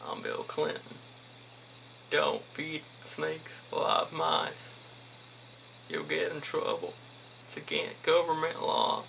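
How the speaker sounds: a hint of a hushed Bill Clinton, giggling through it; Bill Clinton impersonation. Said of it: "doing some sort of Bill Clinton impersonation".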